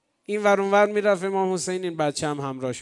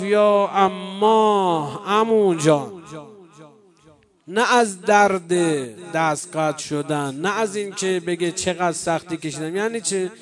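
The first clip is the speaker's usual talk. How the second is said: lamenting recital; 5 dB louder than usual. Acted. Rawza lament narration building up.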